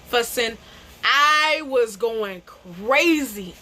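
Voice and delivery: ordinary talk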